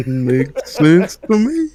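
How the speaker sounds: slow, loud, laughing echo. Real, not acted. Co-host echoing the punchline back, laughing slow and loud. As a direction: crack up while repeating it.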